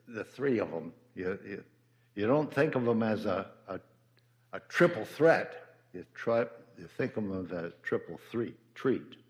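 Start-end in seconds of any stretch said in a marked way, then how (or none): none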